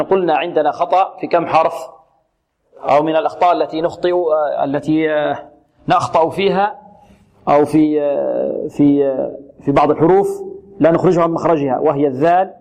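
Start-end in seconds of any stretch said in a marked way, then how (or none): none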